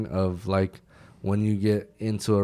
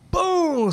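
a sharp, very high and loud boom; smack sound effect; 7 dB louder than usual. Retold or imitated. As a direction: punch the sound like a slap landing. Smacking the soldier awake.

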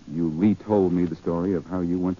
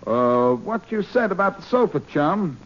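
higher, put-on gruff police voice on the phone; brusque impostor cop, pitched up; posing as Faraday; 6 semitones higher, slower than usual. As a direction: pass yourself off as the inspector. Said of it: "This is Inspector Faraday of the police".

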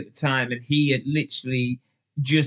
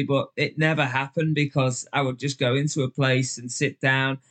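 ordinary talk, nothing marked

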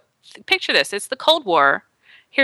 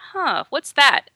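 high, slow puzzled wondering; curious shark; 7 semitones higher, slower than usual. Pretend. Shark noticing a swimmer, slow curious musing. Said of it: "from the shark's perspective".

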